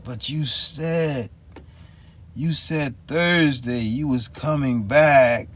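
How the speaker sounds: slow, high insisting voice; imitating the clerk. The clerk insisting he promised Thursday, slow and high. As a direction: drag it out like she's insisting. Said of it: "she kept on saying".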